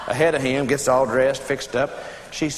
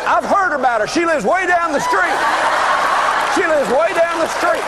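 high, insistent mock alibi; hasty cover-up; 10 semitones higher than usual. Husband covering for his wife to the neighbor, insistent. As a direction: pitch it up and insist, hammed up.

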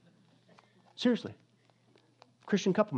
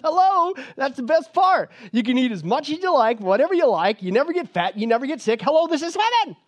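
loud, high-pitched exasperated outburst; 11 semitones higher, 8 dB louder than usual. Acted. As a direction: spell it out loudly, fed up. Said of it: "he's getting a little exasperated".

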